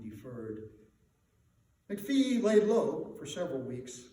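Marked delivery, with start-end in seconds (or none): none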